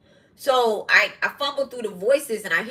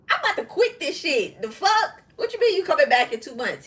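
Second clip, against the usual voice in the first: high-pitched, incredulous retort, half laughing; outraged disbelief; 7 semitones higher than usual. Retold or imitated. Mother protesting to her son about two months, outraged disbelief. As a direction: retell it as a mock-outraged protest, pitch jumping up.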